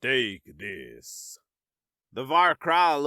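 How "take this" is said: low, calm commanding villain; quiet, cold order, no hurry; eerily calm command. A narrator's reading. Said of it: "His voice was commanding, but eerily calm".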